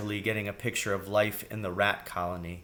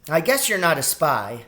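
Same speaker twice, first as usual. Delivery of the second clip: put-on girl rat, a touch let down; understated disappointment, young voice; mildly disappointed; 6 semitones higher, 5 dB louder than usual. A narrator's reading. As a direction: concede it in a raised child's voice. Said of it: "She sounded mildly disappointed".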